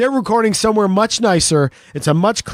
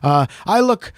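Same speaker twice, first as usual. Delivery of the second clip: normal talking voice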